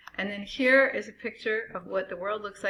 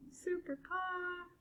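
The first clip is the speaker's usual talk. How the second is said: high, slow, hushed hero title; superhero announcer; 11 semitones higher, 10 dB quieter, slower than usual. Pretend.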